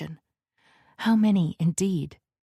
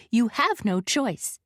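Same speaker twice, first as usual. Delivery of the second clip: curt, higher and slow; said shortly; 6 semitones higher, slower than usual. A narrator's reading. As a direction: clip it off as final. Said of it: "Lara said shortly".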